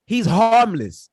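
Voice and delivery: "harmless" drawn out, loud and slow; insist, stretching out "harmless"; emphatic insistence. Real, not acted. Telling co-hosts the lone man posed no threat, emphatic.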